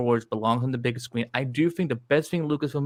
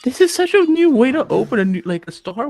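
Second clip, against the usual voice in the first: excited; 11 semitones higher, 8 dB louder than usual. Real, not acted. Awed reaction to the trailer opening.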